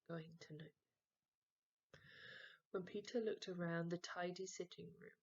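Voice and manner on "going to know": softer hushed whisper. A narrator's reading. Narrator foretells trouble, whispering. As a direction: whisper the dread.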